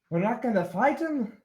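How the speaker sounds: high, loud dwarf protest. Acted. Dwarf objecting to leaving peacefully, loud dwarf voice. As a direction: pitch it up in loud disbelief as a dwarf.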